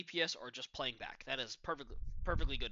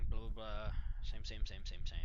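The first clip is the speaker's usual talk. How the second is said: bored drone; 6 semitones lower than usual. Pretend. Bored dismissal of repeated play.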